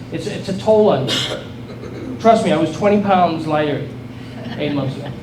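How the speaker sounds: normal talking voice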